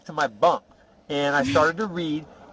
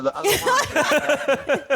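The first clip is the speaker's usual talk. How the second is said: higher, slow, laughing through the words; laughing aside; 11 semitones higher, much slower than usual. Real, not acted. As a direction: chuckle it out while teasing yourself. Laughs while joking about wording.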